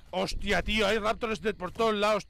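ordinary talk, nothing marked

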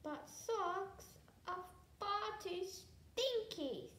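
high, drawn-out dragon whine; mildly tearful. A narrator's reading. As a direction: complain as if through tears, slowly. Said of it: "said the dragon through his tears".

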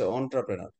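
"এন্টারপ্রেনার" as on-tra-pre-nar; correct pronunciation. Shown as a demonstration. Teacher contrasts speech with spelling for students, "এন্টারপ্রেনার" pronounced on-tra-pre-nar. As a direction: start "এন্টারপ্রেনার" on an "on" sound, not "en". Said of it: "কিন্তু উচ্চারণের বানানটা হচ্ছে".

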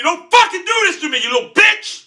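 high, heated shout at the game; angry gamer outburst; frustrated yelling. Real, not acted.

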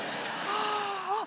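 high shrieked mock gasp; a hammed-up squeal of a gasp. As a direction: gasp like you can't believe it. Mocking an over-eager date.

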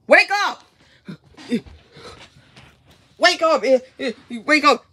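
loud urgent "wake up" yells; shouting wake up. Acted. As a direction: yell at them to get up now. Waking a sleeping friend.

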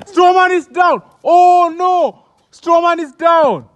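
excited shout. Real, not acted. Commentator shouting to the crowd that Strowman is down, high and loud. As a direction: yell it out as he falls.

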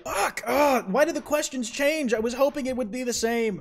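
exasperated high-pitched groaning complaint; frustrated whine. Real, not acted. Frustrated at changed game questions.

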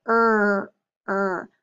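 ER held alone, then repeated; isolated vowel-r sound. Shown as a demonstration. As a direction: sound out "er" like the end of butter.

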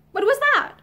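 sudden high, sharp question. A narrator's reading. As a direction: ask sharply, startled. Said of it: "she asked sharply".

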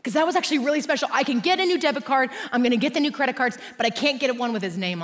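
loud, high, fervent explanation; impassioned preaching. Real, not acted. Preaching at full force.

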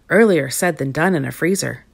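plain reading voice

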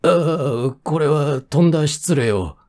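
flustered assistant official, drawn-out opening; flustered apology. A narrator's reading. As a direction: apologize in mild consternation. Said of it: "助役は大狼狽だ".

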